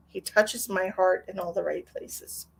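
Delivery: ordinary talk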